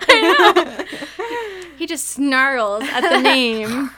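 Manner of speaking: laughing. Real, not acted. Laughing about snarling Edward.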